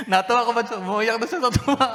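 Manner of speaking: high, laughing through the words. Real, not acted. Pastor laughing as he retells the widow story.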